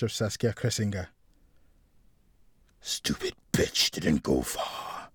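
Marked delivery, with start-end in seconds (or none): 2.8-5.1 slow, low menacing mutter. A narrator's reading. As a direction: mutter it drawn out, deep in the throat. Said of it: "he muttered".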